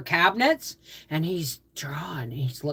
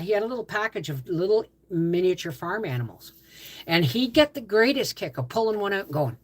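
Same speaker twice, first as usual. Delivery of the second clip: usual speaking voice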